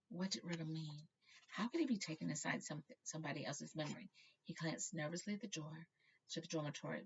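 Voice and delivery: ordinary delivery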